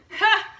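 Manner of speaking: sharp loud burst of laughter. Real, not acted.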